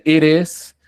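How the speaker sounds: "it is" with the t tapped like a Spanish r; flapped T. Shown as a demonstration. Teacher shows how Americans say it between vowels, flapped T. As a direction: run "it is" together as "iris". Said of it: "porque está entre vocales".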